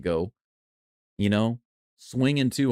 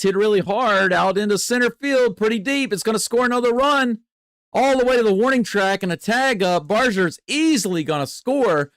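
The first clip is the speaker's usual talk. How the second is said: higher and louder, rising with the action; excited play-by-play; 8 semitones higher, 4 dB louder than usual. Real, not acted. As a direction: call the hit like the run is scoring right now. Big hit, runners scoring.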